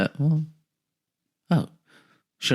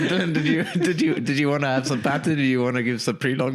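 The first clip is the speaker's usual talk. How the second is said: mocking the host; 5 semitones higher than usual. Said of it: "It's like".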